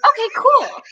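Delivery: very high, squeaky mock-nervous reply; voice up octaves. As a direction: send the voice way up, falling at the end. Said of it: "my voice went up, like, five octaves".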